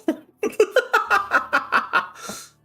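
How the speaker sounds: breathy wheezing giggle; high unvoiced laugh. Real, not acted. Laughs at game dialogue.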